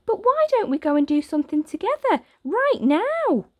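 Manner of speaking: put-on high, fast girl voice; eager girl pushing to play now; excited little girl. Girl urging Oliver to play right now, excited. Ruby proposes playing.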